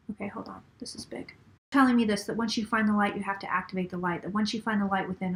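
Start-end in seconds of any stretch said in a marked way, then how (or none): 0.1-1.3 hushed, breathless aside while listening; whispering to herself as the spirits speak. Real, not acted. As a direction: drop to a whisper, something big is coming. Pausing mid-channeling to listen.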